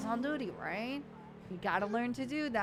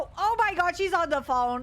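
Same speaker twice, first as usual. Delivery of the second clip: louder, higher burst of alarm; excited exclaiming; 8 semitones higher, 6 dB louder than usual. Real, not acted. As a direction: blurt it out in surprise. Spots driver on phone, exclaims.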